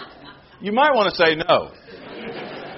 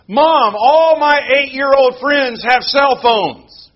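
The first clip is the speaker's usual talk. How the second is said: put-on pleading child; 7 semitones higher, 6 dB louder, slower than usual. Child begging parent in sermon.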